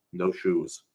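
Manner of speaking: ordinary delivery, nothing marked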